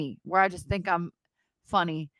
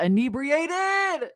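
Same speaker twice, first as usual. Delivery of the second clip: very slow, high-pitched stretched word; drawn-out confession; 6 semitones higher, much slower than usual. Pretend. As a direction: stretch it out theatrically. Playful confession of drunkenness.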